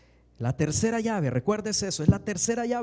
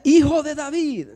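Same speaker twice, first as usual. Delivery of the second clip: high, loud cry for help; a desperate shout, pitched high; 9 semitones higher, 9 dB louder than usual. Shown as a demonstration. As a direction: call out to the master from far off. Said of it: "Él gritaba".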